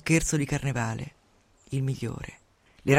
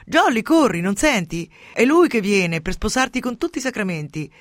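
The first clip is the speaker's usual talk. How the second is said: 1 mocking tease; 6 semitones higher, 6 dB louder than usual. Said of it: "per sfotterla".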